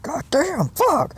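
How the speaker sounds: loud, very high squeal; retold overwhelmed shock. Speaker reliving the angel vision for viewers, overwhelmed. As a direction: shriek the curse as if floored.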